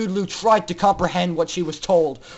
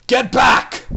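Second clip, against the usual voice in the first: loud barked order; guard's yell; 4 semitones higher, 8 dB louder than usual. Acted. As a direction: shove them back with your voice. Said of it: "More suits yelled".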